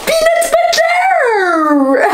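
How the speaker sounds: long high yell of the name; stretched shout. Pretend. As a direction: belt it out, high and slow. Announcing costume, big stretched shout.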